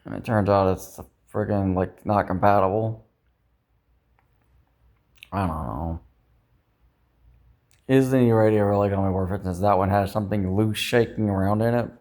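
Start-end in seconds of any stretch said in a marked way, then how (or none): none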